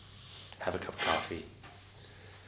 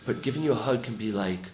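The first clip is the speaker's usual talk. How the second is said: usual speaking voice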